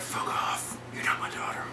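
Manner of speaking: acted low, whispered dismissal; whispered rejection. Joel rejecting Ellie as his daughter, whispered. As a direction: cut her off in a harsh-worded whisper.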